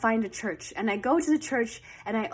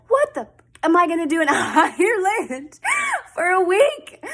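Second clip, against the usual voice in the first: loud, higher, laughing disbelief; incredulous outburst; 7 semitones higher, 6 dB louder than usual. Retold or imitated. Answering the travel agent's Ireland pitch, laughing incredulous shout. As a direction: shout it through a laugh, can't believe it.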